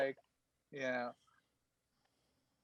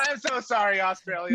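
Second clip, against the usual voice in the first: mock apology; 8 semitones higher, 4 dB louder than usual. Real, not acted. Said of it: "I know that's horrible".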